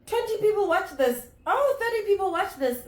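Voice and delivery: high, delighted squeal of surprise; high excited voice. Retold or imitated. Vlogger reacts to her first video's views, high and thrilled. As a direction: gush over the view count in a high voice. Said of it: "I was like".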